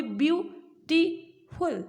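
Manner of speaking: "Beautiful" slowly, in three beats; three syllables. Shown as a demonstration. Teacher counting syllables for students, "beautiful" split slowly in three. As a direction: separate view, टी and फुल in "beautiful". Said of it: "इसका मतलब तीन का".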